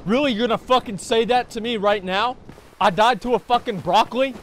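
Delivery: ordinary talk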